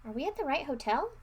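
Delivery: ordinary delivery, nothing marked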